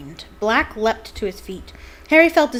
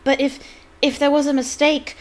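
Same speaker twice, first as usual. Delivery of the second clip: put-on timid girl voice; 7 semitones higher, 5 dB louder than usual. Hermione pleading timidly.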